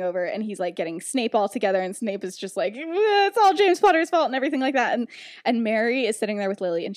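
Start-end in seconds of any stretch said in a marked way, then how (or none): 2.7-4.3 whiny blaming voice. Retold or imitated. Snape blaming James.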